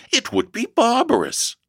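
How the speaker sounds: high-pitched kindly protest; shocked exclamation. A narrator's reading. Mother protests sending guest out, shocked. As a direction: object in appalled dismay. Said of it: "ejaculated kind Missus Alford".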